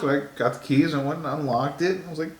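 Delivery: normal talking voice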